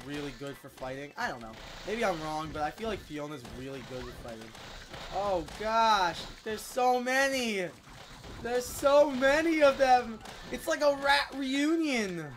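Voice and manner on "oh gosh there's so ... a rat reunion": loud, high, drawn-out dismay; alarmed exclaiming. Real, not acted. Player facing so many rats, alarmed. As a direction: exclaim at a swarm, stretching the words.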